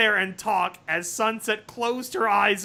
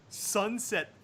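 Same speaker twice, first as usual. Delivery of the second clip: plain reading voice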